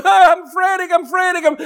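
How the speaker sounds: high, frantic cry; mock panic. Preacher acting a frantic believer, high panicked cry. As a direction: wail like someone losing it.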